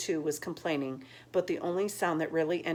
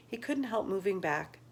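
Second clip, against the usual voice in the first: usual speaking voice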